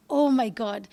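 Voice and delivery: loud, exasperated "oh my God"; mock exclamation. Mother recalling her reaction to the baby daddy lists, mock exclamation. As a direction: exclaim it in exaggerated disbelief.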